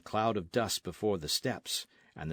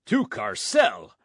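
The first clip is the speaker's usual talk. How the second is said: loud, slow, outraged screech; 8 dB louder, slower than usual. A narrator's reading. Don Carlos hearing he goes to jail, loud outraged screech. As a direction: spit it back in disbelief. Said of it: "Don Carlos screeched".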